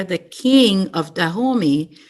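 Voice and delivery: ordinary delivery, nothing marked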